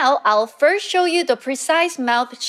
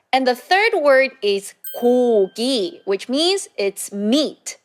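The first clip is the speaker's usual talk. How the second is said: normal talking voice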